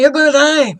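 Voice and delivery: loud, high, hammering repetition; imitated insistent shouting. Woman telling patient he will die, insistent shouting. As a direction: shout it at them as a verdict.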